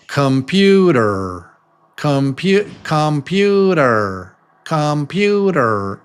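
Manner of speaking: "computer" with every vowel full, KAHM-PYOO-DER; slow, over-full vowels in "computer"; slow and robotic. Shown as a demonstration. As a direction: drag out each syllable of "computer" like a robot. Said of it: "It sounds slow and robotic".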